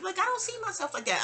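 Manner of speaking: a hint of her shy friend brushing it off; imitating modest Tiny. Mimicking modest Tiny waving off compliments, light high voice. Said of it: "she's modest".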